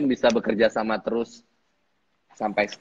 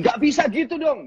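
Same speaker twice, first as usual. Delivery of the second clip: much higher mock nagging whine; imitating his ranting director; 3 dB louder than usual. Actor mimics director scolding him on set, high nagging voice. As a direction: squeal it out like a fussy boss scolding. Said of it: "dia tuh ngamuk-ngamuk sendiri".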